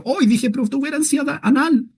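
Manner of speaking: high, hushed mock embarrassment; soft, high-pitched pretend dismay; mock shock. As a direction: squeak it softly, as if scandalized at your own words. Mock shock at own rude word.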